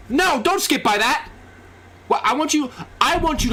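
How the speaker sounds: high, indignant outburst; mock outrage. Real, not acted. Outraged reaction to a clip.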